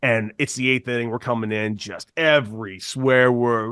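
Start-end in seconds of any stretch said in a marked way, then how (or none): none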